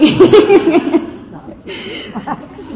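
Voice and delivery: a long, high, loud peal of real laughter. Real, not acted. Telling the audience they felt like Super Bowl winners, a long joyful laugh. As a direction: laugh it out with pure joy.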